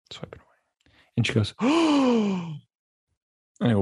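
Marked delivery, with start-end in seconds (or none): 0.1-0.9 breathy whispered repeat of swiping away, voice dropped right out; whispered echo. Shown as a demonstration. Echoing the girlfriend's phone swiping in a whisper. Echoes previous line, whispered. 1.6-2.6 shocked gasp. Retold or imitated. Girlfriend gasps at phone news.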